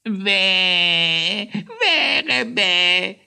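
high, drawn-out wail; pitiful squawk. A narrator's reading. Chatty hare laments his departing friends, squawking pitifully. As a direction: wail miserably in a squeaky voice. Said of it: "quäkte jemand kläglich".

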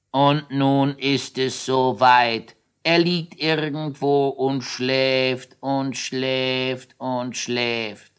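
slow, drawn-out satisfied voice; Drehwurm's slow voice. Acted. Drehwurm slowly announces the giant is asleep. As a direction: savour each word slowly. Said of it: "welcher diese sprach".

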